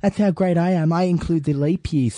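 usual speaking voice

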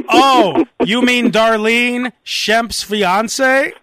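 oversell the made-up story with drawn-out words; overplayed improv fib. Host overdoes it to convince Murphy, laboured emphasis. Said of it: "go out of my way, though, to make".

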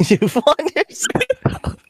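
high, drawn-out mimicry through laughter; laughing echo. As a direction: repeat the punchline in a high, giggly squeal. Echoes the punchline while laughing.

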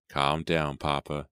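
usual speaking voice

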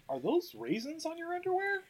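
high, soft questioning voice; imitating a curious questioner; lightly acted film quote. Acting out a film line.

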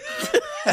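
squeaky burst of laughter; high-pitched giggle. Real, not acted.

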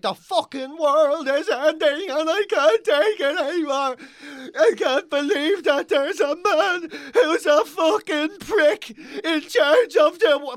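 high, slow, hammed-up wailing whine; fake-sobbing about the end of the world; mocking hysterical liberal.

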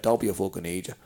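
low, soft fatherly warning; deadpan dad. Retold or imitated. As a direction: imitate dad's quiet word, dropping well down. Dad's terse advice.

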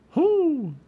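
high, loud owl hoot. A narrator's reading. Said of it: "said the owl".

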